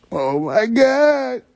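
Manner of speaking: drawn-out, high, slow dismay; stunned oh my God. Acted. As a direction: let the realization drag out.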